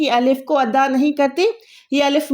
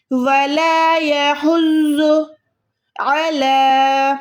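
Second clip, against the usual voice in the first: slow chanted ویلا یحز with a guttural ha; Quran recitation; much slower than usual. Shown as a demonstration. Tutor reciting the verse for her student: slow tajweed chant. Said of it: "یہاں پر بھی دیکھیں".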